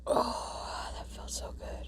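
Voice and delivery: breathy whisper of relief; relieved whisper. Real, not acted. Relief after the burp.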